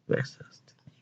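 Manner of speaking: breathy whispered aside, almost no voice. Real, not acted. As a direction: drop to a hushed whisper about her. Whispered aside about sister.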